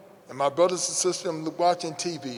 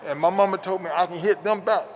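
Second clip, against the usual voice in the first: usual speaking voice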